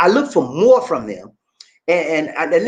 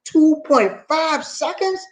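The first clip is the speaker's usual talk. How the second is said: slow, higher, disbelieving repeat; amazed disbelief; 9 semitones higher, much slower than usual. Real, not acted. Host repeating a viewer's Tesla figure in awe. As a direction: savor the number in disbelief.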